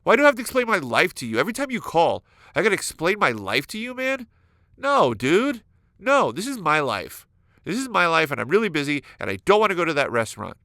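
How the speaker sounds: hammed-up irritated rant at the nosy friend; aggressive outburst. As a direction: snap back at the friend, mock angry. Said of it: "you get aggressive again".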